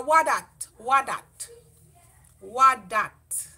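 "what that" in broad Jamaican patois, falling. Shown as a demonstration. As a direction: ask "what that" the Jamaican way, dropping the is. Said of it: "sometimes you say".

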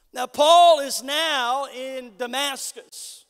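ordinary talk

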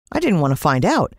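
ordinary delivery

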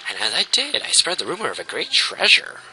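singsong voice swooping up and down; fawning servant. Acted. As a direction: report obsequiously to your queen. Voices servant reporting to queen.

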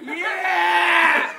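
loud joyful yell; excited shout. Real, not acted. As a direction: shout it with joy. Joyful gift reveal shout.

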